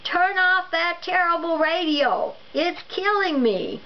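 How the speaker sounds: high, pained shout at the radio; husband shouting at his wife to kill the radio. Acted. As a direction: yell it as if the noise hurts. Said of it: "he shouted".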